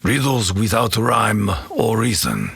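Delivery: ordinary delivery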